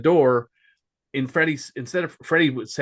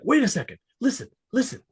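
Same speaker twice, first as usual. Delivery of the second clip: high, urgent hush-call; imitating an alarmed warning; alarmed whisper-call; 9 semitones higher than usual. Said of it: "he's like".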